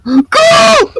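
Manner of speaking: high urgent yell; panicked shout. Real, not acted. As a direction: shout run at the top of your voice. Zombie coming at them.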